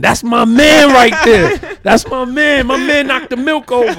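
a mock boast about their friend in the video, cracking up; excited bragging. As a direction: shout it out proudly for your boy.

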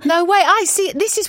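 genuinely amazed, pitch jumps up; surprised. Real, not acted. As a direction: blurt it out in disbelief. Astonished by short flight time.

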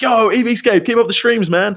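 imitating a fan, high and loud; excited fan. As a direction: hype it like a stranger fan. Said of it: "He's like".

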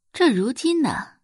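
ordinary delivery

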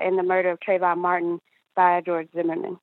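usual speaking voice

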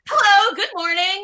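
high, giggly mock hello; laughing greeting. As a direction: squeak it out through laughter. Cheerful mock greeting to trucker.

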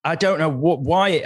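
ordinary talk, nothing marked

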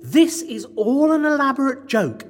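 loud, high, emphatic reassurance; overconfident insistence. Acted. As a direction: talk over everyone to calm them. Insists it is a joke.

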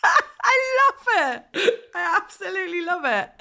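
high, slow, emotional gush, near tears; moved delight. Real, not acted. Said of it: "I'm actually kind of speechless".

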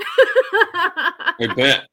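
high squeal of laughter; laughing. Real, not acted. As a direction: laugh the words out. Laughs at book pitch.

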